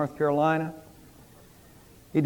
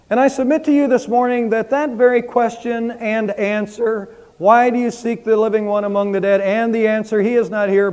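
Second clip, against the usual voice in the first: higher, louder, emphatic proclamation; preaching; 5 semitones higher, 3 dB louder than usual. Acted. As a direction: preach it with conviction. Pastor proclaims sermon's central message.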